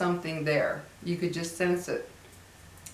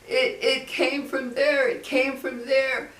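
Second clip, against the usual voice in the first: put-on higher, slow frightened child's voice; scared little boy; 7 semitones higher, slower than usual. Retelling the boy at the skylight, higher and slow. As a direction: play the spooked kid pointing up. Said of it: "one of the little boys that was there".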